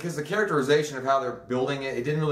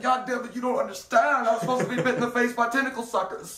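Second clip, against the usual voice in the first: higher put-on voice, mild mock anger; mock angry old man; 9 semitones higher than usual. Host voices the old man's retort, high mock anger. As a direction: grumble it pitched high as a cranky old man.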